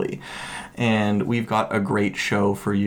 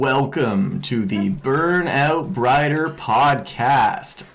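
announcer voice; 3 semitones higher, much slower than usual. Acted. Dramatic podcast intro.

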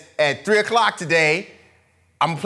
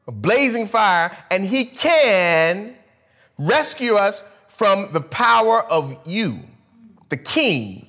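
slow, raised, deliberate scripture; solemn reading; 3 semitones higher, slower than usual. Acted. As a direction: proclaim each word slowly.

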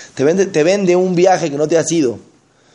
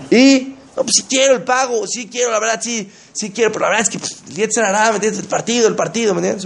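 higher-pitched mock pleading; voiced waverer, pitched up; excuse-making congregant; 6 semitones higher than usual. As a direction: imitate someone wriggling out with excuses. Voicing a congregant torn by excuses.

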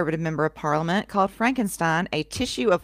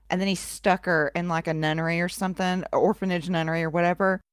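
normal talking voice

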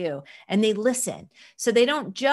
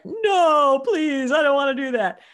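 higher, louder pleading protest; inner groan; 7 semitones higher, 7 dB louder than usual. Retold or imitated. Mother's inner voice refusing her kids, loud mock pleading. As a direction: beg to be spared, half joking. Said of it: "that's what I'm saying inside".

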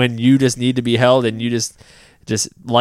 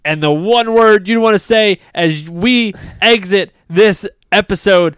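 slow, high-pitched build to a long shouted final word; building announcer sign-off; 6 semitones higher, 5 dB louder, slower than usual. Pretend. Host cueing a co-host's closing scream, building announcer shout. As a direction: stretch each phrase toward a shouted "is".

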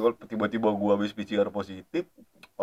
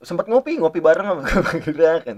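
laughing; 8 semitones higher, 9 dB louder than usual. Real, not acted. Joking story, laughing.

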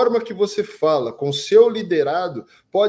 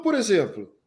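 ordinary talk, nothing marked

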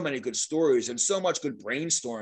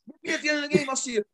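retold yell; 11 semitones higher, faster than usual. Angry player challenging the mascot, high mock yell. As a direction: imitate a player shouting down the hallway. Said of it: "He's yelling at me".

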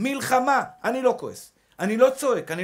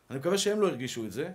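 normal talking voice

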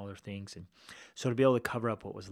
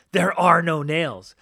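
slow, booming, higher "There are no nails."; mock loud repeat; 9 semitones higher, 17 dB louder, slower than usual. Narrator repeating the local man's answer to listeners, loud and slow. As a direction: hammer the line out loud and slow for effect. Retelling the punchline loudly.

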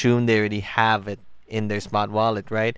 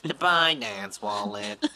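slow, higher mock robot voice; 6 semitones higher, slower than usual. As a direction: imitate a robot, slowly. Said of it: "Sounded like a robot over there".

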